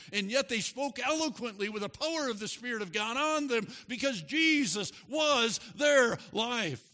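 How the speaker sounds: high, loud sermon rising to a shout; fervent preaching. Acted. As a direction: build and shout the last line.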